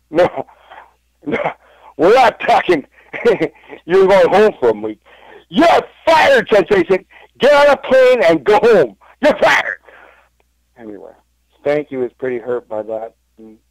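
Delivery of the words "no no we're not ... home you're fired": high, loud strutting Ric Flair rant. Retold or imitated. Still playing Ric Flair for his housemates, high and loud. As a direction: keep yelling like Ric Flair, big and high. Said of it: "Spanky's like".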